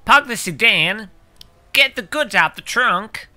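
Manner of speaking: over-the-top fake American accent; mock American accent. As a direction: show off a cartoonish American voice. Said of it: "that's a hundred percent American accent".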